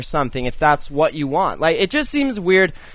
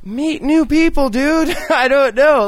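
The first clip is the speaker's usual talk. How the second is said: much higher and louder, half laughing; exasperated outburst; 9 semitones higher, 3 dB louder than usual. Real, not acted. Urging the guy to make new friends, exasperated and high. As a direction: throw up your hands and push the advice out.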